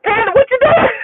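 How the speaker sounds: high-pitched retold panicked cry; freaking out. Passenger to driver as the truck slides back, panicked. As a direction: cry out in mock alarm, way up high. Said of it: "I'm over there freaking out".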